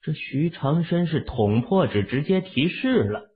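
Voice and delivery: plain reading voice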